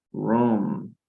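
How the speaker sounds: ordinary delivery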